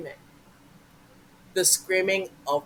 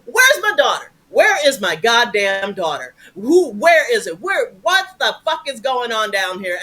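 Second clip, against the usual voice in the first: high, loud furious mom yelling; mom's screaming voice; 4 semitones higher, 6 dB louder than usual. Retold or imitated. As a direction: scream it like an angry little mother. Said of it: "I hear my mama going".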